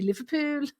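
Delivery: light Scouse lilt on Liverpool; a hint of Scouse; Liverpool accent. Shown as a demonstration. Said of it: "can't say Liverpool in a normal accent".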